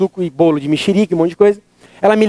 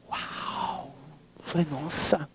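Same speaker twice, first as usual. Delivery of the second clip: awed whisper; 5 semitones lower, 19 dB quieter, much slower than usual. Retold or imitated.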